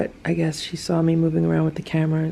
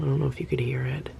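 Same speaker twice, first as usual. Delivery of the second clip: whispering; 3 semitones lower, 8 dB quieter, faster than usual. Real, not acted. Whispered aside.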